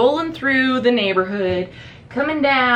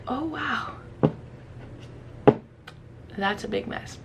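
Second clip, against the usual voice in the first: much softer, understated shock; stunned hush; 10 dB quieter than usual. Retold or imitated. Seeing the melted kitchen, stunned and hushed. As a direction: say it small, taking in the damage. First sight of burnt kitchen.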